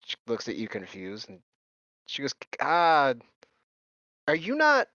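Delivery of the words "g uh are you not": surprised. Acted.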